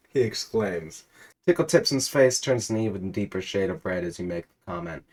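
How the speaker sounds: ordinary delivery, nothing marked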